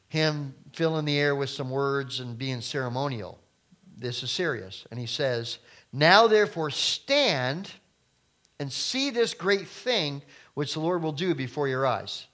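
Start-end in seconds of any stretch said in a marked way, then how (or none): 5.9-12.3 slow, loud commanding proclamation; slow booming scripture call; proclaiming scripture. Acted. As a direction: declare it like the prophet himself. Rereads verse with emphasis.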